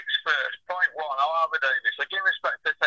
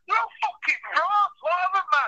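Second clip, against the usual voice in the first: much higher, ranting yell at the frauds; angry shout; 13 semitones higher than usual. Real, not acted. Boxing pundit on the phone, fed up with promoters, shouting. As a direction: let the rant boil over into a shout. Rant peaking, calling everyone frauds.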